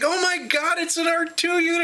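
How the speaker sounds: high, thrilled mock squeal; fan squeal. Actor spotting the droid, squealing with thrilled delight. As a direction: gush like you just spotted your idol. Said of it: "She's like".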